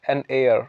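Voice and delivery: "heir" said like air, no h; silent H. Shown as a demonstration. Teacher introducing the word to learners, silent h in "heir". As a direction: link "an" straight into the vowel of "heir". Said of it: "हेयर नहीं कहते".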